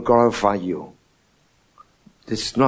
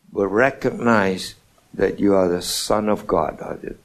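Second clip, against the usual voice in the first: ordinary talk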